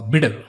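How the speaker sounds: bitter said like "bidder"; double T as D. Shown as a demonstration. Teacher offering the American version, bitter with a D sound. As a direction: tap the T in bitter. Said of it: "it becomes a D".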